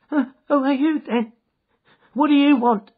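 high, startled, quavering voice. Acted. Little man caught by the shoulder, quavering. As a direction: blurt it out in loud alarm. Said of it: "he asked in a quavering voice".

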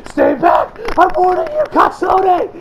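loud cornered-villain shout; mock panicked warning. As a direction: warn him off in hammed-up panic.

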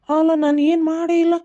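loud, high-pitched plea of innocence; exasperated denial. Acted. Man left accused by partner, exasperated denial. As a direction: protest loudly, voice pitched right up.

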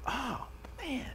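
high, breathy disappointed sigh of words; mock dismay at a cliffhanger; letdown groan. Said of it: "And we go".

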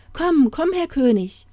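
high, hurried-sounding plea; anxious woman's entreaty; urgent pleading. Acted. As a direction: beg him to come along. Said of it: "bat sie".

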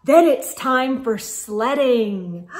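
put-on lively read-aloud to children; storybook narration. Reading a snowman story to children, lively narration. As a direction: tell it like a bedtime picture book. Reads picture book aloud.

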